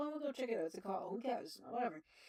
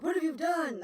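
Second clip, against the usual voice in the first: higher, louder furious demand; angry demand; 8 semitones higher, 10 dB louder than usual. Retold or imitated. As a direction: snap at him in anger. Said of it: "He's like".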